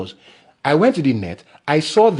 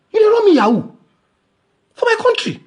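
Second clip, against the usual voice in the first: outraged high cry. Real, not acted. Podcaster raging at the bank over ten dollars, outraged shrieking. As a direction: scream the accusation at the bank.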